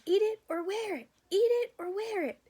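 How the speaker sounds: high, loud tantrum scream; toddler scream. Acted. Toddler screaming at his father across the table. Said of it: "Fudge screamed".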